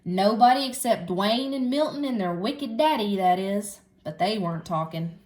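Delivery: ordinary delivery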